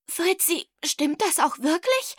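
coughing, strangled question; choked rasp. A narrator's reading. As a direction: croak it out after choking on a drink. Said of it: "röchelte sie".

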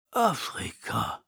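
slow, halting; a stunned, stuttering Afrika; stammered. A narrator's reading. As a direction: let the word stumble out in awe. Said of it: "stottert Abu".

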